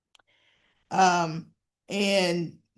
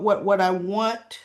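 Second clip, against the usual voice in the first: ordinary delivery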